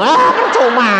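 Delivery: high, loud scoffing; exasperated retort. Retold or imitated. Relative scoffing that going is pointless, loud and high. Retold argument reply.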